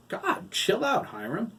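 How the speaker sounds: loud, high, slow retort; startled retort. A narrator's reading. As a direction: push back, startled.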